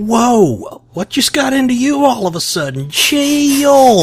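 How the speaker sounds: high startled yelp; alarmed. Acted. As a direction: pull them back in alarm. Alarmed at the viewer.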